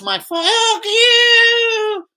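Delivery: very high, slow yowling cat voice; a fan screeching like a cat; cat noise yowl. Pretend. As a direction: yowl it like an angry cat across the stadium. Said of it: "making cat noises".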